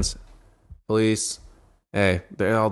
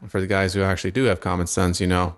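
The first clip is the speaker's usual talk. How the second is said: ordinary talk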